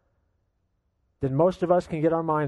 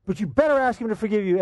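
railing father; 6 semitones higher than usual. Retold or imitated. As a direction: keep ranting without a breath. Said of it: "father kept continuing to rail".

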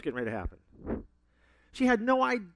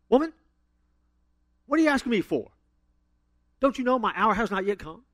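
loud, higher, indignant questioning; rude misreading; 4 semitones higher, 6 dB louder than usual. Shown as a demonstration. Preacher shows how we misread Jesus, rude and emphatic. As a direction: snap it like a rude reply to mom. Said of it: "we add emphasis to it in the wrong way".

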